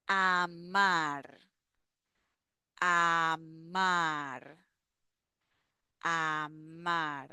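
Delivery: Amar said slowly, each syllable a-mar clear and level; slowly for learners. Shown as a demonstration. Teacher saying Amar slowly for students to repeat. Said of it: "I'm gonna say the word slowly".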